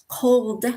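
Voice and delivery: Cold with the final d sounded; careful, complete Cold; full final consonant. Shown as a demonstration. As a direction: finish Cold on a clear d. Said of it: "drop off the last letter".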